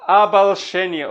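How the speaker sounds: slow, measured reading that leans on each stressed beat; slow Russian verse recital; slow poetry recitation. Shown as a demonstration. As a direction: read the verse slowly, marking the rhythm. Reciting the next verse line.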